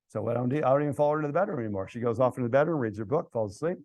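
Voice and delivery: ordinary talk, nothing marked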